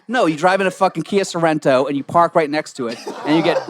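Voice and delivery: ordinary talk, nothing marked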